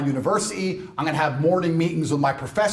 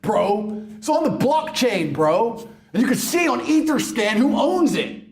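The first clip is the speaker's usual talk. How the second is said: mock crypto bro; 7 semitones higher than usual. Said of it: "people were saying to me".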